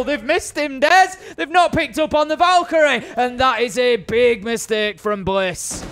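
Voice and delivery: high, loud esports call; excited play-by-play. Real, not acted. Caster telling viewers about a big mistake, excited play-by-play. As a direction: punch out the costly mistake with rising urgency.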